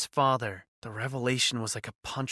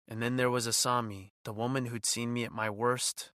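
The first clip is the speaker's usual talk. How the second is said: ordinary delivery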